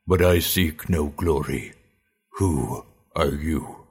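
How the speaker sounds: slow, guarded orc voice; measured, wary warrior. A narrator's reading. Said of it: "the wary orc began".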